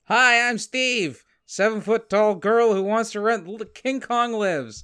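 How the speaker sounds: character voice. Acted. Callback bit in a character voice.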